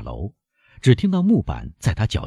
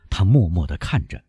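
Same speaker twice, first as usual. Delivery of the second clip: plain reading voice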